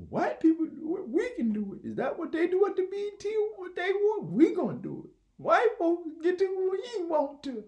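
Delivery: high, loud put-on preacher voice; mock activist voice. Mocking activists, a high loud rally voice. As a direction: ham up a rally speech. Mocking BET crowd quoted.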